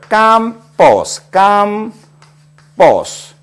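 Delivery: 깜버스 said slowly, stressing 깜 high; drawn-out demo of 깜버스 with stress on 깜; stress on second-to-last syllable. Shown as a demonstration. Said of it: "S자로 끝난 경우를 한번 볼까요?". (깜버스 끝에서 두 번째 음절 강세로 천천히)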